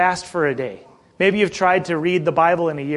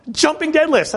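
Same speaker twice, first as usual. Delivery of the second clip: incredulous; 10 semitones higher than usual. Retold or imitated. Disbelief at silly exercise.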